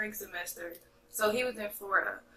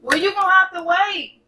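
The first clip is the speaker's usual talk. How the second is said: raised, higher voice telling someone to wait; calling out annoyed; 9 semitones higher, 10 dB louder than usual. Real, not acted. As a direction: shout it across the house, impatient. Telling interrupting cousin to wait.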